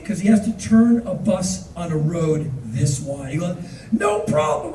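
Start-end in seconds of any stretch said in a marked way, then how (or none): 3.9-4.7 high-pitched, carefree dismissal; breezy reassurance. Retold or imitated. Bus driver answers tourist's apology, high and breezy. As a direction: imitate the driver waving off the trouble. Driver brushes off hard turn.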